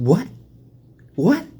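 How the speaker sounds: high, loud disbelief; shocked what. Retold or imitated. Learning from the recruiter he joined the Army, high shocked disbelief. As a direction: shoot it up in shock.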